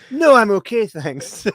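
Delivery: high, loud overacted refusal; rehearsed movie line. Shown as a demonstration. Joker rehearses lines for a movie, overacted loud refusal. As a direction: belt out the opening, then drop off on thanks. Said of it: "I'm just learning my lines for a movie".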